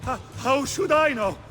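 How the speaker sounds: high-pitched, stammered denial; rattled protest. Acted. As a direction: protest with the voice jumping up. Beaten man questioned by attacker.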